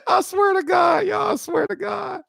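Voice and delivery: real high, squeaky swearing through laughter; helpless falsetto after a laughing fit; cracking up. Real, not acted. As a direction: wheeze it out, voice shot way up. Helpless after laughing at own story.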